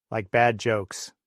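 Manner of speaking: ordinary delivery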